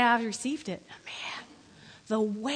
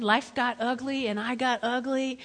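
ordinary talk

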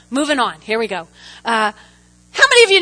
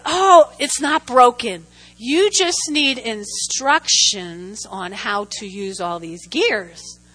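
slow, measured imitation of a patient son; mock-patient coaching, settling lower; patient instructor; 4 semitones lower, slower than usual. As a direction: explain it very slowly, word by word. Voices patient son instructing her.

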